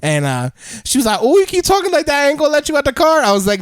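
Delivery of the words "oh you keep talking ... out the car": high-pitched mock woman's voice; imitated woman's warning. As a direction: put on a high voice for her. Voicing woman from his story.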